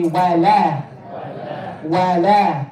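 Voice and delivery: wala with thin, open a vowels; light waw. Shown as a demonstration. Teacher models wala for students, light waw. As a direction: keep wala light, "wa-la" not "wo-lo". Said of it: "we wanna make it light".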